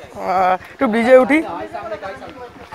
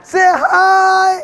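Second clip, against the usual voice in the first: calling out; 6 semitones higher than usual. Real, not acted. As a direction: shout it to the group. Calling to arriving friends.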